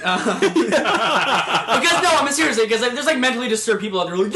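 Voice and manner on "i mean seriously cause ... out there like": ordinary talk, nothing marked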